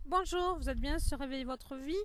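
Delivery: talking while munching a snack; words round a bite of food. Real, not acted. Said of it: "je grignote".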